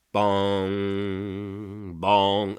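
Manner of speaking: low, drawn-out imitation of a bell; solemn bell toll. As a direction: intone it like a slowly tolling bell. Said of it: "The solemn toll".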